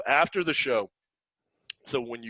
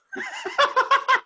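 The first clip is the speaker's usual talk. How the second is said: a sudden squeal of laughter, much higher; 8 dB louder than usual. Real, not acted. Teller cracking up at his friend's punchline. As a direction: shriek with laughter.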